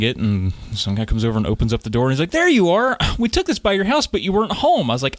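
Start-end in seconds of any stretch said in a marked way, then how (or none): 2.3-4.9 higher, lightly voiced postal worker; a hint of an oblivious cheery clerk; cheerful clerk greeting. Teller mimics worker opening the door, higher cheery greeting.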